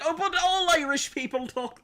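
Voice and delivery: very high, loud hammed-up Irish squawk; mock yelled retort. Streamer answering the Irish objector, high hammed-up yell. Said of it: "I'm gonna be like".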